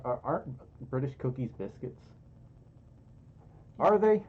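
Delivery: ordinary talk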